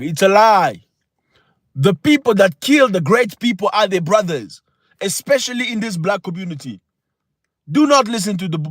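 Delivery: emphatic motivational oratory, rising high on key phrases; impassioned preaching. Real, not acted. Host exhorting viewers about true brothers, impassioned preaching. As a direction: preach it to the crowd, punching each point.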